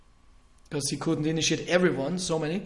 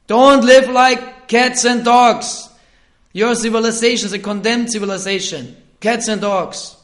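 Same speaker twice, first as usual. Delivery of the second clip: stern preaching; 6 semitones higher, 12 dB louder than usual. Retold or imitated. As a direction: thunder it like a preacher condemning. Preaching Prabhupada's stern message.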